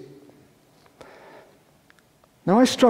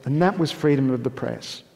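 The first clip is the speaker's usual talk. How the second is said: ordinary talk, nothing marked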